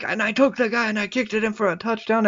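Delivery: mock oblivious sports chatter; football-obsessed date. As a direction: lightly imitate someone prattling on about football. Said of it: "continues to just talk about playing football".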